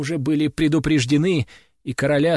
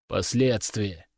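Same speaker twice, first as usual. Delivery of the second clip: slow grim king; 6 semitones lower, 4 dB quieter, slower than usual. Acted. King weighs grimly, slow.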